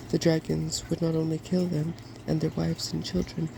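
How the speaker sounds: ordinary delivery, nothing marked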